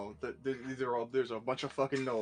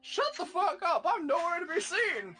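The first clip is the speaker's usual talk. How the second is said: high, laughing mock yell; 14 semitones higher, 3 dB louder than usual. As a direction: shout it in jest. Joking outburst about absent player.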